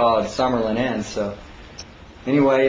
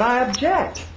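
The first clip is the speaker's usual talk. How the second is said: mock objection; 11 semitones higher than usual. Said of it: "And I said".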